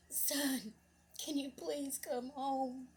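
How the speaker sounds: high, slow, emotional pleading; retold tearful plea. Mother begging her lost son to come home, tearful. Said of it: "she was just bawling".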